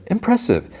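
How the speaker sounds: very high-pitched retold compliment; mock admiration. Blade fighter to the man dodging her, high mock admiration. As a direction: lift it way up, lightly impressed.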